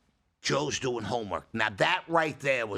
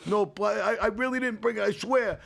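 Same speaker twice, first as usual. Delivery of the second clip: put-on pleading teen; 8 semitones higher than usual. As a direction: play the caught kid swearing innocence. Imitating teen friend denying guilt.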